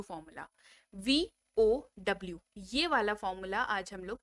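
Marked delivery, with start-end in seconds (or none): 0.9-2.4 वीओडब्ल्यू drawn out letter by letter, slow; slow spelled out. Shown as a demonstration. Teacher naming the formula to students, slowly spelled out.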